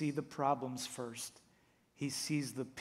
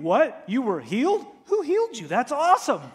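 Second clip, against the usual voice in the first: mock delight; 11 semitones higher, 14 dB louder than usual. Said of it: "Don't you think they would be asking".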